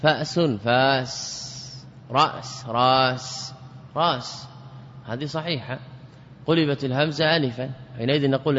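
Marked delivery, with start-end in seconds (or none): 0.0-3.5 فأس then فاس, رأس then راس, slow and drawn out; hamza dropped. Shown as a demonstration. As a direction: say فأس with the glottal stop, then فاس with a long a. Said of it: "في نحو فأس ورأس". (نطق الهمزة ثم إبدالها ألفا ببطء)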